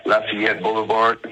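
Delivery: ordinary talk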